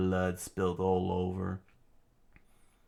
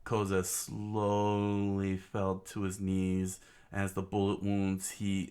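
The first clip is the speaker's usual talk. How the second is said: usual speaking voice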